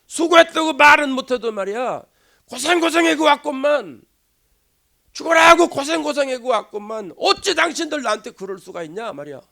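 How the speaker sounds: acted loud, high-pitched tirade; angry outburst. Imagined Peter blowing up at his church critics, loudly indignant. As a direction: play aggrieved fury, pushing out each complaint. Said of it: "버럭 화를 내면서".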